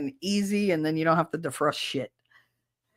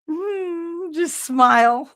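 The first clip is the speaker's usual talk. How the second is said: forced smile; 11 semitones higher, 5 dB louder, much slower than usual. Retold or imitated.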